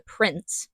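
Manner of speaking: "prince" drawn out and leaned on pointedly; pointed weight on the word "prince"; stress on prince. Retold or imitated. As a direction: land hard on "prince" as a hint. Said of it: "Emphasis on prince".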